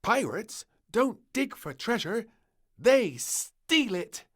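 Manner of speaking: high, loud, slowing on the last words; mischievous princess. Acted. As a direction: relish the naughty idea. Princess explaining.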